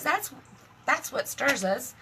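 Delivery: normal talking voice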